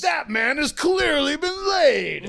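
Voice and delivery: high, slow, stately narration; mock announcer. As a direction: say it like a smug commentator. Mock onlooker commenting.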